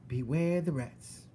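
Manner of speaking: slow, high parroting; Mig repeating. Acted. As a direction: echo the warning blankly.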